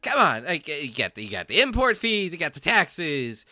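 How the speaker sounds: high, loud indignant merchant voice; haggling shopkeeper. Acted. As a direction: talk up the price like a pushy dealer. Shopkeeper haggling over silencer price.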